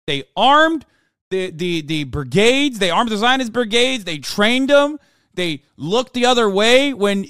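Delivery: high, loud, outraged yelling; furious rant. Real, not acted. Streamer berating pundit on clip, furious shouting. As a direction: scream down the pundit, indignant.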